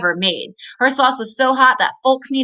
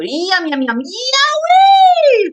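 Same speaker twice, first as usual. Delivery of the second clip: burning yowie shout; 6 dB louder, much slower than usual. Acted. Hot sauce hits the coyote.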